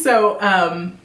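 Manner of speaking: usual speaking voice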